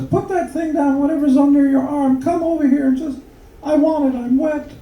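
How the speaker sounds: very high falsetto wife; wife's voice. Acted. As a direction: put on a mock woman's falsetto.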